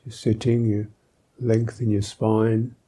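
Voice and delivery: ordinary talk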